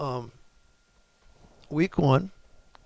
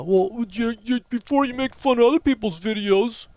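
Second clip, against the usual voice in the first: snide mock-preachy voice, louder and higher; mocking a critic; 8 semitones higher, 4 dB louder than usual. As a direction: parody a smug commenter. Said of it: "that asshole that said".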